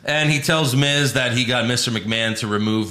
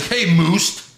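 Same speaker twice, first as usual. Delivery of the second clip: mocking Miz; 5 semitones higher than usual. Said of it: "He's like".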